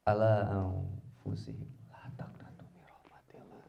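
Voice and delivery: slow, whispered Quran verse; reverent, unvoiced reciting; hushed recitation. Real, not acted. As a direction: let the verse fade into breath.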